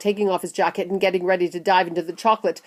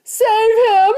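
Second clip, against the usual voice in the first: very high, loud frantic scream; panicked shriek; 9 dB louder than usual. A narrator's reading. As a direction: scream for someone to save your son. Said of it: "screamed Missus Gloop".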